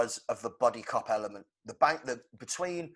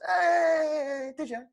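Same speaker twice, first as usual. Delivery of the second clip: much higher, louder squeal of fake delight; mocking Bruce; 5 dB louder, much slower than usual. Host mocking Bruce greeting the interviewer with a sarcastic high hey. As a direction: overact Bruce's sarcastic cheer. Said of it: "And Bruce goes".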